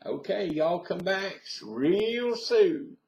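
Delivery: high, drawn-out Southern farewell; Southern US accent. Shown as a demonstration. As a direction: send him off in a slow, lilting Southern drawl. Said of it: "so that's my southern accent".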